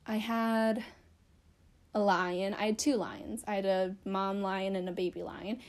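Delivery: ordinary talk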